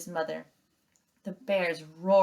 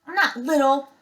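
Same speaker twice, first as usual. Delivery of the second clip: snapping; 8 semitones higher, 8 dB louder than usual. Acted. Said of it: "snapped Thorn under his breath".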